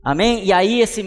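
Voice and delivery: high, loud pulpit fervour; impassioned sermon voice; fervent preaching. Acted. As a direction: drive the message home to the crowd. Preaching God's answer, rising fervour.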